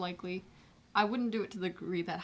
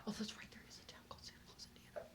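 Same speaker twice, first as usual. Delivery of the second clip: whispering; 4 semitones higher, 16 dB quieter, faster than usual. Real, not acted. Aside comment.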